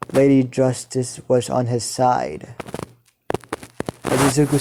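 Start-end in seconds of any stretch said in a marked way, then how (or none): none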